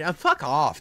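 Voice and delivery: usual speaking voice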